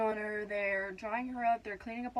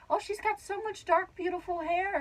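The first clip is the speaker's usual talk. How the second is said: high-pitched imitation of an admiring midwife; retold admiration; 9 semitones higher than usual. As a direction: coo it higher, as if marvelling. Retold midwife admiring baby's hair.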